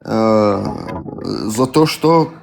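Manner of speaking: usual speaking voice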